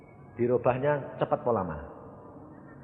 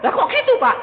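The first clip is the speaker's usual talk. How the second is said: loud, very high-pitched demand; mock indignant question; 10 dB louder, faster than usual. Complainer questions pastor about Christians, loud high protest. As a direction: cry out the question in disbelief.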